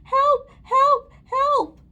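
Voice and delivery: very high, loud cries; screaming for help. A narrator's reading. Lady with hair on her tummy, screaming. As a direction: scream each word separately. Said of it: "who was yelling and screaming".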